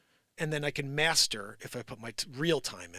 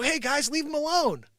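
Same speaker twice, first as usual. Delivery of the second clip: higher, louder call to stop them; retold call-out; 13 semitones higher, 6 dB louder than usual. Calling to bullies beating a kid, a raised shout. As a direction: shout to break up the fight. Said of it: "And I go".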